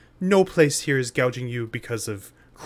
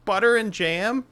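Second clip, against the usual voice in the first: put-on kid voice; 6 semitones higher than usual. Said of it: "I love your kid voice".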